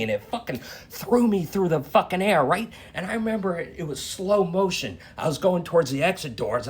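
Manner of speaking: normal talking voice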